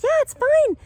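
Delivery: very high, loud reassurance; breezy insistence. Retold or imitated. Traveler insists to newlywed, high-pitched reassurance. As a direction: brush it off in a squeaky-high voice.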